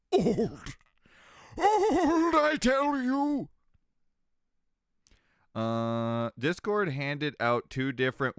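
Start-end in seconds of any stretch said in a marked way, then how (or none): 0.1-3.5 very high, slow, louder creaky elder; mock old geezer. Streamer complaining about age, hammed-up old geezer. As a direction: wail it like a cranky old-timer.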